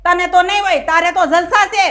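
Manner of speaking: loud, heated complaint; mother-in-law shouting at her daughter-in-law; angry shouting. Acted. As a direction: shout your hurt at her. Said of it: "અત્યારે જ તમે કેટલી બૂમો પાડો છો".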